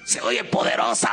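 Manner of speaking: hoarse, voiceless put-on power voice; ronco. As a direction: play a hoarse voice you think sounds powerful. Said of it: "Porque me oía ronco". (voz ronca y fingida de poderoso)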